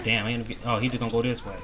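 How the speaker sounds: normal talking voice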